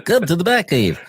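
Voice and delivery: playful copy of a hero voice, pitched high; mimicking Batman. Host copies the actor's superhero line, mock hero voice. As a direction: echo the superhero command in a put-on voice.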